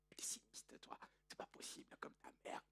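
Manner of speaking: unvoiced scornful whisper; a whispered dig about his mother; whispered jab. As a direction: mutter the jab under your breath. Laban's scornful aside.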